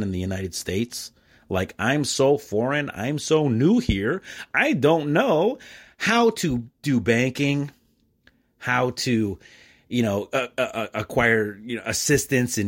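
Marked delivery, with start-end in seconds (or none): 1.5-11.6 slow, drawn-out sarcastic delivery; sarcastically slow and deliberate; mock-clueless newcomer. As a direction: stretch each word as if new to everything. Mimics being treated as a newcomer.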